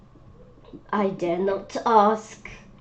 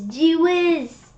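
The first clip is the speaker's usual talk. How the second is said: loud, higher exclamation; amazed; 8 semitones higher, 5 dB louder than usual. Real, not acted. Child seeing the hen tower, loud amazed cry. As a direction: burst out in delight.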